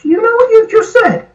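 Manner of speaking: shocked disbelief. Retold or imitated. Lady reacting to his Welsh phrase, shocked and high. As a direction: sound scandalised at what he just said.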